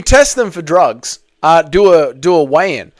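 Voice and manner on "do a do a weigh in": ordinary delivery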